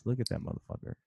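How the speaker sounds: hushed, unvoiced admiring aside; whispered. Real, not acted. Admiring the Roger mascot, whispered. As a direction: whisper it in awe.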